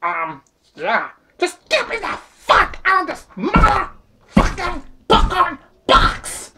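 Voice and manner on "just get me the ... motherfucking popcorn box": high, loud demand to be let out; trapped shouting. Acted. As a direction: yell from inside the box. Penguin trapped in popcorn box.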